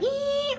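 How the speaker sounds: very high thin squeal imitating the noise; high-pitched squeal. Imitating the tone he heard in the room, very high squeal. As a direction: squeal it out like a piercing tone. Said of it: "very high-pitched ge-, noise, like".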